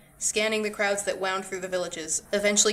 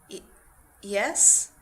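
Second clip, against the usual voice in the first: stammering; 3 dB louder than usual. A narrator's reading. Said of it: "Diedrich stammered".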